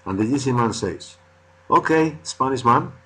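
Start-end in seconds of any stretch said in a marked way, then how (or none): 1.7-2.9 high, loud hawker's hail; eager salesman call. Acted. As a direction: hail him like a pushy seller.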